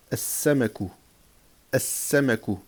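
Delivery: "as-samaku" with a long s, no L; doubled first letter. Shown as a demonstration. Teacher drilling sun-letter words, doubled s. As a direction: hold the s in "as-samaku". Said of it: "on va doubler".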